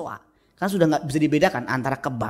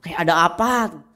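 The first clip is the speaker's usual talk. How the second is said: high mock wail of alarm; hammed-up panicky yell; imitated screaming; 8 semitones higher, 5 dB louder, slower than usual. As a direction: cry out as if something terrible happened. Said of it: "Teriaknya itu kayak".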